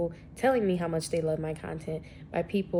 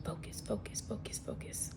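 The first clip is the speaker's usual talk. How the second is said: whispered focus, focus, much softer; whispered to self; 14 dB quieter than usual. Real, not acted. Vlogger whispering to herself to stop rambling. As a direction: whisper it to yourself to get back on track. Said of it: "Back to the matter at hand".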